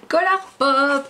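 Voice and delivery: high, drawn-out singsong reveal; playful announcement. Real, not acted. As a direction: stretch the brand name out high and playfully. Playful reveal of favourite brand.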